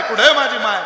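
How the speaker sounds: tearful repeated plea, trailing down; crying for mother. Acted. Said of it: "रडत होतं".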